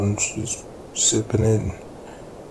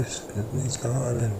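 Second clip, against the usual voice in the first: ordinary talk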